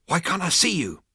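high-pitched alarmed call; exasperated demand. A narrator's reading. Zaphod to the invisible voice, exasperated. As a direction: throw the question at empty air.

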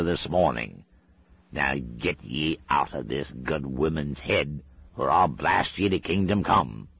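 Scottish threat. Acted. Shadwell threatening the demon with his hand.

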